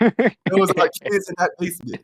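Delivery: high, slow mocking voice; mocking put-on voice. Mocking the opponent in a high put-on voice. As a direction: put on a silly high voice to mock.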